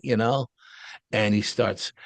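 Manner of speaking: ordinary talk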